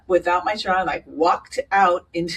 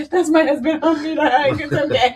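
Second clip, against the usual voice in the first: laughing refusal; 9 semitones higher, 5 dB louder than usual. Retold or imitated. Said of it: "tries to hug me".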